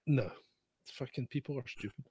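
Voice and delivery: ordinary talk, nothing marked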